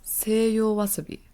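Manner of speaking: seiyo wasabi slowly, with Japanese vowels; Japanese pronunciation. Shown as a demonstration. Host teaching the word for horseradish, Japanese pronunciation of seiyo wasabi. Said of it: "And in Japanese".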